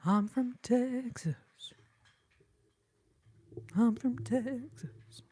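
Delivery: loud, high, stretched-out drawl; hammed-up, drawn-out announcement; playful proclamation. Playful repeated put-on declaration.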